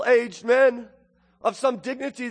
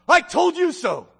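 high-pitched mocking reproach; scornful taunt; 7 semitones higher, 4 dB louder than usual. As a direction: rub it in like a vindicated parent. Mimics scornful parent's reproach.

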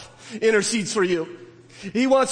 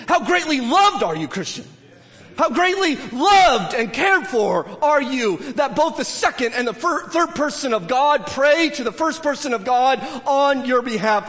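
fervent preaching; 3 semitones higher than usual. Acted. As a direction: proclaim it with rising fervour. Preaching at full force.

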